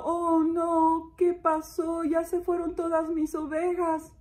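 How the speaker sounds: high, dismayed lament; sad shepherd. Acted. Shepherd finding his sheep gone, sad. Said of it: "Está muy triste".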